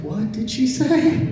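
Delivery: mock shocked double take; stunned. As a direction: drag it out in disbelief. Shocked at wife's request.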